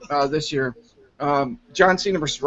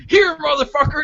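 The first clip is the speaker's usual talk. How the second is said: mock Vince; 9 semitones higher, 4 dB louder than usual. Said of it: "He's like".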